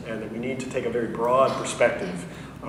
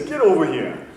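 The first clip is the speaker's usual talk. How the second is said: put-on Maine fisherman accent; 4 semitones higher, 4 dB louder than usual. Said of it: "with a strong Maine fisherman's accent".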